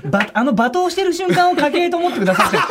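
laughing while talking. Real, not acted.

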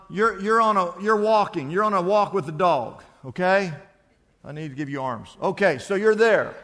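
ordinary talk

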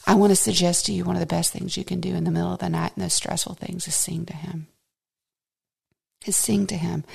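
fast, unvoiced confiding whisper. Real, not acted. Preacher urging listeners in a hushed whisper. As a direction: lean in and whisper it.

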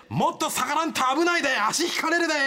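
high, loud warning call; stern shout. Acted. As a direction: bark a warning from the float. Float puller scolds a spectator.

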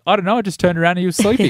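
put-on quick, high New Zealand accent. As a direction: rattle it off in a Kiwi accent. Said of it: "do your New Zealand accent".

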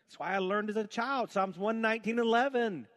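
loud, fervent preaching. Real, not acted. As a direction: preach it with full force. Preaching at full force.

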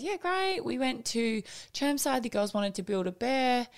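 put-on light upbeat teen reply; chirpy babysitter. Babysitter cheerfully tells mum about the day.

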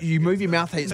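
ordinary talk

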